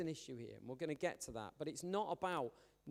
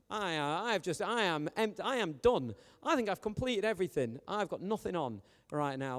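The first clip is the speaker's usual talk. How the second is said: loud, higher, emphatic boast; mock triumphant declaration; 4 semitones higher, 7 dB louder than usual. Preacher mimicking someone with every task finished, mock triumphant. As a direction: proclaim it grandly, as a joke.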